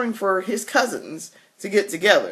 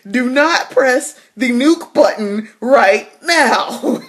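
high, slow, laughing warning; slow giggling scold to her dad, pitched up; 6 semitones higher, 6 dB louder, slower than usual. Retold or imitated. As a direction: drag it out through laughter.

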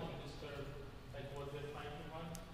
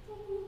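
high sung ooh as a name; 6 dB louder than usual. Retold or imitated. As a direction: sing a single high ooh. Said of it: "they'll be like".

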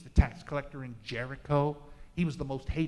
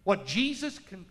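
ordinary delivery

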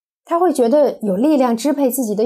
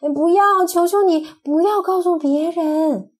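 higher, hammed-up begging; mock pleading; 7 semitones higher than usual. Doctor mock-begging the child not to tell, playful pleading. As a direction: beg playfully, not really upset. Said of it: "他就开始哀求说".

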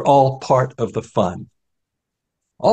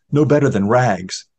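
ordinary delivery

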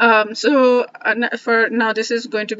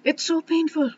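real pain; 6 semitones higher, slower than usual. Real, not acted. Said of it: "I'm getting emotional".

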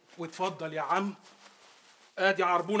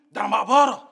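boastful shout; 8 dB louder than usual. Acted. Boastful threat.

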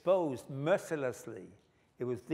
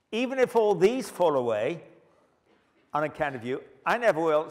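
loud, high, fervent promise; bold vow; 4 semitones higher, 8 dB louder than usual. Acted. Preacher voicing Peter's boast, loud and high. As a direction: declare it like a boastful disciple.

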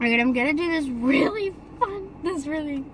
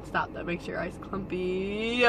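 ordinary talk, nothing marked

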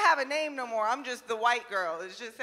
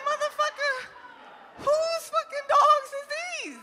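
pitched way up, disbelieving; incredulous high retort; 12 semitones higher than usual. Retold or imitated. Comic telling her date off, high and indignant. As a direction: squeal it at him in disbelief.

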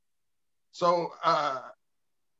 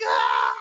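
mock scream; 13 semitones lower, 7 dB louder than usual. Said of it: "Trump's like".

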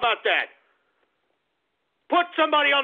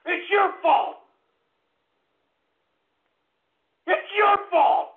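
angry shout; 7 semitones higher, slower than usual. Acted. Ranting host yelling blame at his trolls, furious shout. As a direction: bellow the blame at the callers.

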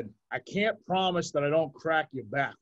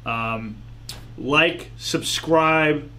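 podcast outro; 8 dB louder than usual. Acted. Closing the show for listeners in a louder sign-off. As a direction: wrap up the show for the audience.

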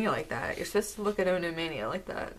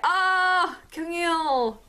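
high, loud excited cry; high-pitched burst of surprise; thrilled reveal; 9 semitones higher, 5 dB louder, much slower than usual. Real, not acted. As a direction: shriek the name on seeing the card. Thrilled reveal of the next card.

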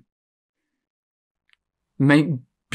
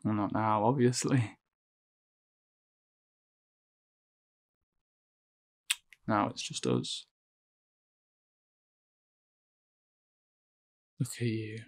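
quiet pity; 3 semitones lower, 12 dB quieter than usual. Acted.

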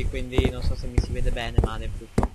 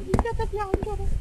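high squeaky mock voice. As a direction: squeak it in a silly voice. Joking high voice.

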